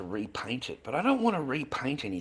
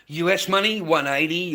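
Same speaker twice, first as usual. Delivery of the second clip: higher, louder burst; emphatic; 6 semitones higher, 8 dB louder than usual. Real, not acted. Explaining US price to viewers, emphatic. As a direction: punch out the price conversion.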